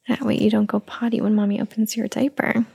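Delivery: ordinary talk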